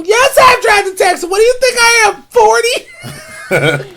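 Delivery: very high, squealing indignation; hammed-up high-pitched outrage; indignant character voice. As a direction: protest in an offended falsetto. Indignant character snaps back, very high.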